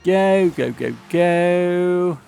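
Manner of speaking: normal talking voice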